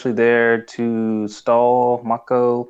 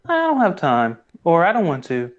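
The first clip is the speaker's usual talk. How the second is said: high-pitched mock brush-off; whiny-high imitation of a refusal; dismissive Bolin; 10 semitones higher than usual. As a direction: pitch it up, waving off the request. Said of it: "Bolin is like".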